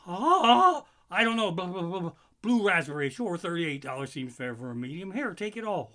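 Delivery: high, loud frazzled babble; panicked rambling. Retold or imitated. Comic replaying his panicked self paying for a snow cone. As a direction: blurt it out still shaken.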